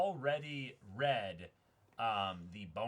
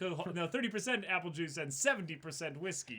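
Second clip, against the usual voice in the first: higher, louder laughing admission; excited confession; 10 semitones higher, 6 dB louder than usual. Real, not acted. Host admits the drink is mostly whiskey, giddy and high. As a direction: own up to the strong drink, giddy.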